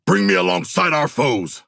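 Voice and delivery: ordinary delivery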